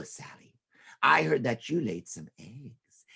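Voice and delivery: slow, sly unvoiced hiss; sneaky whisper. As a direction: whisper like a scheming snake. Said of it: "someone very sneaky, a snake".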